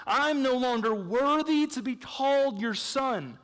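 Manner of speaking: a touch of the humbled son; quoting the prodigal son. Retold or imitated. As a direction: read it out like the son begging. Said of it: "he's saying twice in this passage".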